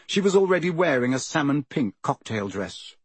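ordinary delivery, nothing marked

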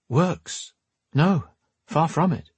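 gentle noblewoman. Acted. Lenora gently corrects Drake.

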